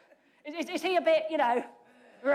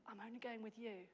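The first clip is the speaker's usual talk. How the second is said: much softer, almost under the breath; softly read quote; 3 semitones lower, 20 dB quieter, faster than usual. Shown as a demonstration. Preacher quotes Barak's line softly for the congregation. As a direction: say it quietly, letting it hang. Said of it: "How do you hear that".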